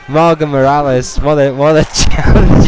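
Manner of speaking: high laughing words fighting through; laughing while talking. Real, not acted. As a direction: announce while cracking up.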